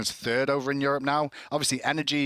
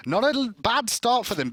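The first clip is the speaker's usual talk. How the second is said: ordinary delivery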